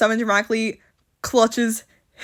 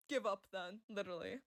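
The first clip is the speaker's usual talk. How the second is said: very soft, lower-pitched comment; quiet aside; 3 semitones lower, 25 dB quieter than usual. Real, not acted. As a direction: drop to a low, tiny aside. Own dry aside after the yell.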